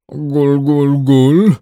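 bubbling gul gul gul; gargling. A narrator's reading. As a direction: gargle through water. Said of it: "zabulgotał ponownie niedźwiadek".

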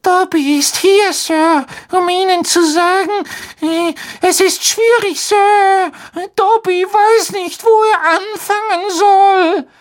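very high squeaky elf voice, hesitant; a timid little elf struggling for words; nervous house-elf. A narrator's reading.

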